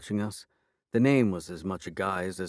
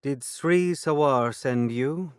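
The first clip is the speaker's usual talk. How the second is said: slow, higher fairy character voice; 6 semitones higher, 5 dB louder, slower than usual. A narrator's reading. Fairy voiced in read story.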